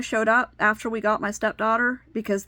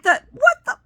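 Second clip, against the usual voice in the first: choked off mid-curse, floored by the answer; incredulous outburst; 14 semitones higher, 7 dB louder than usual. Retold or imitated. To the caseworker excusing the abuse, a high, loud, cut-off what the. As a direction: voice shoots way up and loud in disbelief. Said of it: "I'm like".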